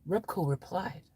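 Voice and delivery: ordinary talk, nothing marked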